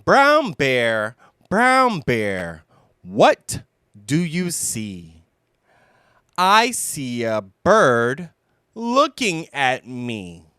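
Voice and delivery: usual speaking voice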